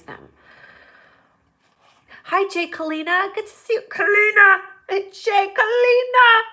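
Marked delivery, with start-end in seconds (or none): 2.3-6.4 very high, building to loud calls; excited greeting. Real, not acted. Host greeting an arriving viewer, high excited shout. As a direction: squeal the name, stretching the last one.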